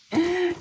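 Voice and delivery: high, loud squeal of laughter. Real, not acted. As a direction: squeal with joy. Delighted at parcel.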